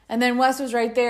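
usual speaking voice

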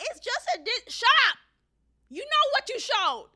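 high, loud, scoffing retort; exasperated outburst. Real, not acted. Commentator scoffing at the teacher's excuse, exasperated outburst. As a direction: cut in with a loud, high-pitched protest.

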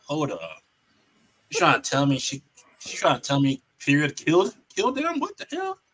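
incredulous replay of a reaction; retold disbelief. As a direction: voice climbing high in mock shock. Acting out his shocked reaction.

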